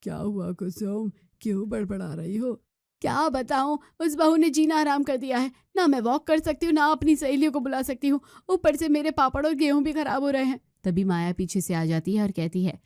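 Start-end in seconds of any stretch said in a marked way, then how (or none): 0.0-2.5 softer, unbothered husband voice; calm husband. Acted. Husband asks grumbling wife, soft and unbothered. Husband Ramesh reading paper. 3.0-10.6 aggrieved complaint. Acted. Kusum complains to husband.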